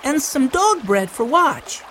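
put-on high little-boy voice; high-pitched child's request; child calling out. A narrator's reading. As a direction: add it eagerly like a small child. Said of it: "called Benny".

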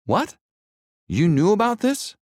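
high, shocked disbelief; indignant surprise. A narrator's reading. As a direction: demand an answer, stung. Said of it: "he asked them".

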